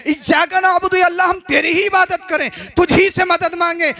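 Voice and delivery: forceful, ringing sermon delivery; emphatic Urdu sermon oratory; impassioned preaching. Real, not acted. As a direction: preach it to a whole congregation, phrase by phrase. Resumes full-force preaching.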